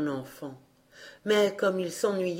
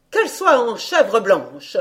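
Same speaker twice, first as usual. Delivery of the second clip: stepmother's choice; 4 semitones higher, 7 dB louder than usual. A narrator's reading. Stepmother chooses.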